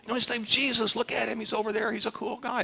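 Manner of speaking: high-pitched, eager imitation of the healed man; enthusiastic retelling. Healed man telling the Pharisees about Jesus, imitated enthusiastically. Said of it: "He just may have said".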